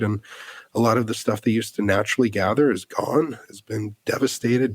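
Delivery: normal talking voice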